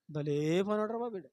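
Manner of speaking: soft, sarcastic compliment; mocking praise. Master mocking a servant who comes late, quiet sarcasm. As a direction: praise him but mean the opposite. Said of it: "అంటే ఎగతాళిగా అనమాట".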